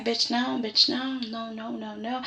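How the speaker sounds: hushed aside of disbelief; muttered disapproval. Retold or imitated. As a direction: mutter it under your breath, trailing to almost nothing. Retelling own disapproving reaction.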